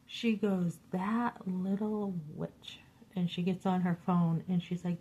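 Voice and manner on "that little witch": slow, soft bitter muttering; quiet slow seething aside; muttered insult. Retold or imitated. Coworker angry about unpaid wages.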